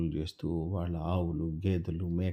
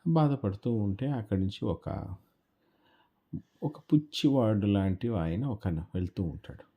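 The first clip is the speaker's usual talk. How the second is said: ordinary delivery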